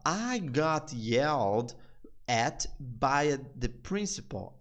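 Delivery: very slow, words pulled apart; very slow demo. Shown as a demonstration. Teacher dissecting the show's line, very slow. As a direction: break it up for learners. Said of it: "Então ele fala".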